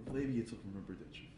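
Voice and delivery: ordinary delivery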